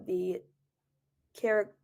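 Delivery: usual speaking voice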